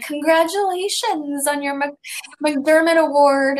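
high, drawn-out, overeager congratulations; gushing congratulations. Retold or imitated. Tipsy fan congratulating a star, gushing.

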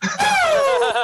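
sudden high-pitched laugh; a loud disbelieving laugh; high burst of laughter. Real, not acted. Stunned by friend's top three.

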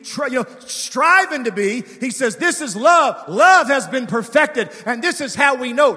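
loud, high, rising preacher cadence; fiery revival sermon; full-force preaching. Acted. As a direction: push the church to its feet.

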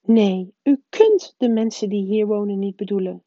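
the strict witch crying out in protest; cried out. A narrator's reading. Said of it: "riep professor Anderling".

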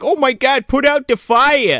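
high, slowed panicked plea; fake-frantic cry to put out the fire; mock panic. Host acting out Brooklyn freaking out, high mock panic. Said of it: "Brooklyn starts freaking out".

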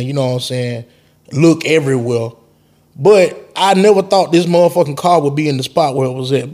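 ordinary delivery

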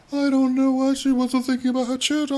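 high, mocking whiny imitation; mocking Ken. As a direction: parody his smug cluelessness. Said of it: "Ken's like".

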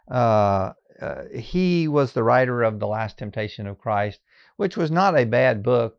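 normal talking voice

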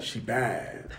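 low, soft dazed murmur; a hint of lovestruck awe; student whispering low about her; smitten mumble. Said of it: "He's like".

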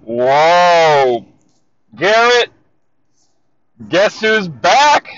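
loud, drawn-out excited greeting; loud, slow hype greeting to a friend; excited shout. Real, not acted. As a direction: burst in loud and thrilled to be back.

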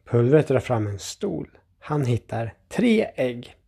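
ordinary delivery

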